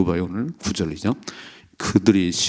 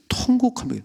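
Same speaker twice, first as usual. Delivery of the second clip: wailing cry. Acted. Illustrates Hannah wailing.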